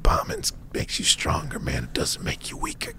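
imitating a weathered tour manager, voice dropped to a whisper; hushed confiding. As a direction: lean in and let the secret out softly. Old beatnik giving whispered advice.

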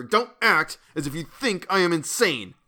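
high, loud, agitated protest; fearful cry. A narrator's reading. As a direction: cry out in frightened warning. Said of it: "I cried, trembling with fear".

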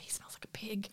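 put-on whispered child's jab; a sly unvoiced whisper of a scornful girl; whispered insult. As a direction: hiss it under the breath like a sulky kid. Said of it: "She's like".

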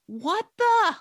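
high, loud outraged yell; sudden high-pitched scolding shriek; retold scream. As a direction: screech it out at someone's intrusion. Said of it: "she's screaming at me".

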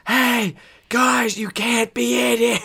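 high, loud shout across the gym; imitated coach yelling. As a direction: mimic an irate coach hollering from afar. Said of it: "starts yelling at us".

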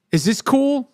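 "Is this cool?" lifting high on "cool"; questioning. Retold or imitated. Nurse checks with her patient, questioning "Is this cool?". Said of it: "Like she's asking me".

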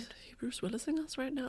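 hushed conspiratorial whisper; mock secretive whisper. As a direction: lean in and whisper it. Conspiratorial aside.

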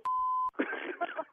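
high, breathy disbelieving laugh; real laugh. Real, not acted. Pranked clerk at his coworker, disbelieving laugh. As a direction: laugh at being fooled.

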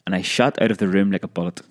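usual speaking voice

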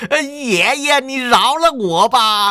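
groveling swindler begging. A narrator's reading. Kneeling fake immortal begs the hero for mercy, whining high. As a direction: beg for your life on your knees. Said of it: "下跪磕头认错".

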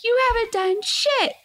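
high-pitched indignant retort; retold going off. Wife snaps back at her husband, retold angry outburst. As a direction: re-enact telling him off, pitch jumping up. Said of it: "I'm going off on him".

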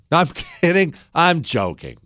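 normal talking voice

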